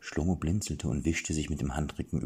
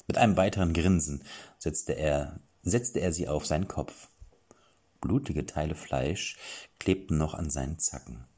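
ordinary talk, nothing marked

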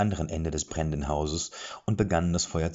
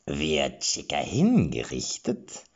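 gleeful question; 2 semitones lower, slower than usual. A narrator's reading. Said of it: "fragte Gimpel freudig".

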